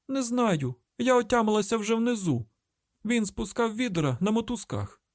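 very high, frantic answer; distressed captive. A narrator's reading. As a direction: answer desperately from the bottom of a pit. Frightened captive answers from pit.

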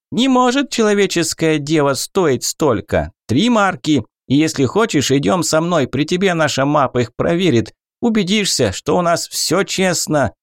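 high, haggling goblin. Acted. Goblin bargaining.

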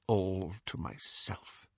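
quiet, slow and bitter. A narrator's reading. Headmaster confessing his greed to Harry, bitterly. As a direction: let the bitterness sink the words. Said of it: "His tone was unbearably bitter".

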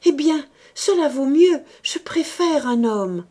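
high, loud, distraught insistence; frantic. A narrator's reading. Woman chooses to confess to a man, frantic. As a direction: seize on it desperately. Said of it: "répéta-t-elle affolée".

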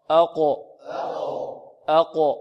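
أقو with a heavy qaf, bounced on release; thick q popped open in أقو; قلقلة مفخمة. Shown as a demonstration. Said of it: "فخم القاف". (أقو بقاف مفخمة مقلقلة)

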